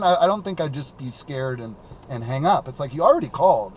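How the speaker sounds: normal talking voice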